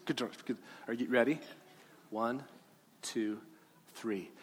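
normal talking voice

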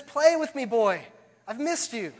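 high, loud burst of joy; tree overjoyed to see the boy again. Acted. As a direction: beg the boy to play, thrilled he is back. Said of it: "overwhelmed with joy and says".

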